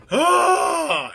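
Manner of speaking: pained cry. Real, not acted. Cry after sigh.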